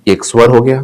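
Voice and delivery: usual speaking voice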